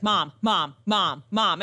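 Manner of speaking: put-on toddler calling mom. Toddler trying to get mom's attention, calling insistently. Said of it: "he's like".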